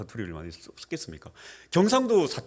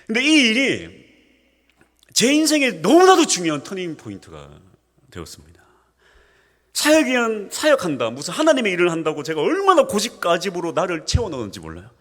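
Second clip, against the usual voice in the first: full-force preaching; 12 semitones higher, 8 dB louder than usual. Acted. Pastor stressing his turning point to the church, loud. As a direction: lean hard into each word.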